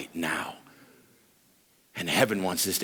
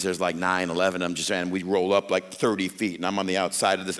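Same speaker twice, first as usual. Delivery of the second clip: normal talking voice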